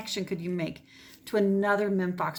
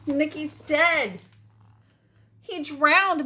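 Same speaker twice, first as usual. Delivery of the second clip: higher, louder, slow grieving wail; cried; 8 semitones higher, 7 dB louder, much slower than usual. Acted. Maggie finding her drowned cricket, mock crying. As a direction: mourn the cricket aloud, mildly acted. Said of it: "Maggie cried".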